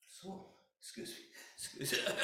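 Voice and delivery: real tears breaking the words; choked up. Real, not acted. Choked up retelling his conversion to the audience. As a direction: push the apology out through tears. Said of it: "I can't do this without crying".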